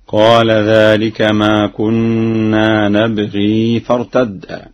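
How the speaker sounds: نبغي recited "nabghii" with long final ii; slow recitation, holding the ya of نبغي into the next word; ya kept when joining. Shown as a demonstration. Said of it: "حالات الوصل هكذا".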